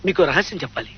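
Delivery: low, hushed confidential tone; soft, secretive aside; confiding a secret. Acted.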